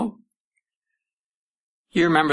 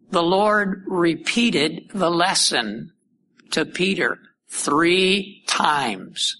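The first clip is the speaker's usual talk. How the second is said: usual speaking voice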